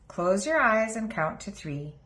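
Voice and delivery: plain reading voice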